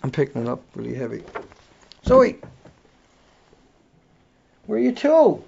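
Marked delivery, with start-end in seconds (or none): 2.0-5.4 high, loud call across the room; calling a pet. Real, not acted. Host searching for Zoe, loud high calling.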